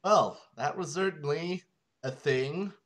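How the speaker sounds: usual speaking voice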